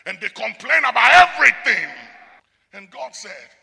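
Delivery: loud, ringing pulpit warning; full-voiced sermon delivery; preaching at full force. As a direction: thunder it at the congregation like a preacher. Preaching on Israel's ingratitude.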